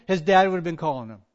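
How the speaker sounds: ordinary delivery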